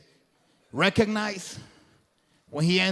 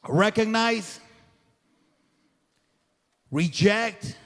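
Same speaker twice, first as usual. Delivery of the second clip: normal talking voice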